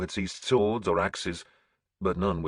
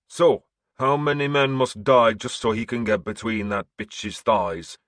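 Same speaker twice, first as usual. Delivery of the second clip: a hint of a higher, harder Orvar voice; demanding; 4 semitones higher, 4 dB louder than usual. A narrator's reading. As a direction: press the question, lightly voiced. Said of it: "Orvar demanded".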